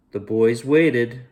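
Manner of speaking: ordinary delivery, nothing marked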